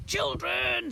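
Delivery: high, loud horrified cry; screaming. A narrator's reading. Twin seeing jelly children, high alarmed scream. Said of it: "screamed Edmond".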